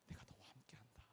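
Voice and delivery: soft unvoiced whisper of 함께한다; whispered promise. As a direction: breathe the promise like a secret. God's promise whispered, then spoken. (속삭이듯 "내가 너와 함께한다")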